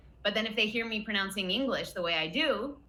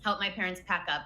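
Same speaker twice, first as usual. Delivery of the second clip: normal talking voice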